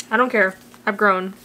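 ordinary talk, nothing marked

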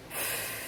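low, voiceless puff of annoyance; exasperated huff. Real, not acted. Reviewer letting off steam after ranting about characters, exasperated huff. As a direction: blow out your frustration.

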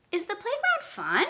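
high "playground", "fun" rising sharply; asked like a question. Shown as a demonstration. Teacher repeats with pupils, question rising on "fun". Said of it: "you're gonna ask it like a question".

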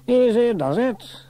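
high, drawn-out call upward; calling a caution. A narrator's reading. Station officer holding the ladder for climbers, calling caution.

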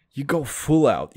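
ordinary talk

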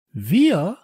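high, loud incredulous question; surprised cry. A narrator's reading. Leo hearing they are good detectives, surprised cry. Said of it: "rief Leo".

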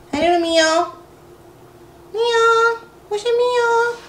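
high sing-song voice to a puppy. Real, not acted. Baby talk to the puppy.

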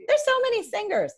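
high, loud mock worry; mock fretting singers. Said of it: "And they're like".

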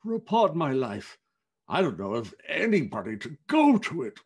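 voiced fat businessman, emphatic; great fat man. A narrator's reading. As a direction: play a callous big gentleman. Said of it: "said the same speaker".

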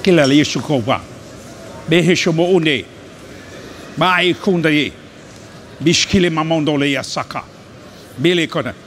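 loud, forceful prayer in tongues; punchy bursts of fervent tongues; praying in tongues. Real, not acted. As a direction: pray out boldly in unknown syllables. Prays aloud in tongues.

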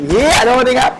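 sudden high, loud cry of triumph; excited outburst. Real, not acted. Gamer yelling as his sniper shot finally hits, excited. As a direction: blurt it out as the shot lands.

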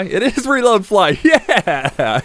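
delighted, stretch the cheer out; excited. Real, not acted. Player finds reload fly is on, excited.

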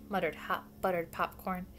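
usual speaking voice

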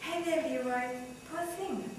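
soft, soothing sympathy; hushed, pitying greeting; gentle. A narrator's reading. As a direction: speak gently, as to someone hurt. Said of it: "She spoke gently to him".

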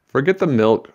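exasperated teacher cries out to the principal; cried out. Acted. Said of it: "she cried".

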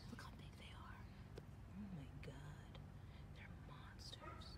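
hushed, horrified whisper; scared little whisper. Real, not acted. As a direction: whisper like the ants are monsters. Whispering about huge ants.

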